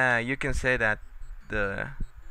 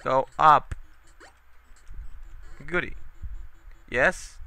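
usual speaking voice

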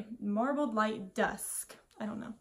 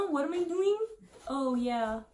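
high surprised; 4 semitones higher, 3 dB louder than usual. Real, not acted. Tipsy confusion.

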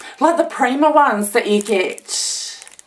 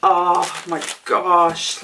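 ordinary delivery

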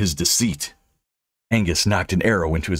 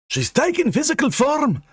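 high-pitched urgent objection; agitated high protest; objecting. A narrator's reading. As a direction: object in a much higher character voice. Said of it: "Angus objected".

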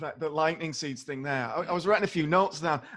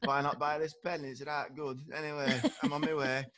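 slightly softer, offhand northern ad man; mock northern advert voice; 2 semitones lower, 4 dB quieter than usual. Co-host mocks a northern advert selling a pen, softer and offhand. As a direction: toss off a lazy northern sales pitch. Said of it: "Northerners in adverts now are this kind of".

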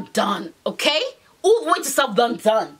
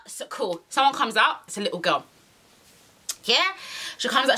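usual speaking voice

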